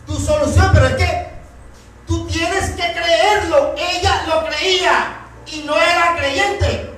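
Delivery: predicación apasionada, más aguda y fuerte; proclámalo desde el púlpito con fervor; predicación intensa. Acted. Predica con fuerza creciente. (sermón a plena voz)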